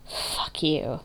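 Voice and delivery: voice cracking with anger. A narrator's reading. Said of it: "her voice cracking with anger".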